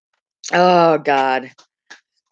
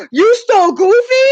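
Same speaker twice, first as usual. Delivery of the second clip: high, loud astonished question; stunned mom, pitched way up; mock shock; 12 semitones higher, 6 dB louder than usual. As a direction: re-enact a scandalized squeal. Retold shock at son's theft.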